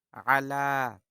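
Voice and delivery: আলা with the la stretched long and loud; আলা, lengthened vowel on la. Shown as a demonstration. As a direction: draw out the la in আলা. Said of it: "আলা লম্বা করতে হবে".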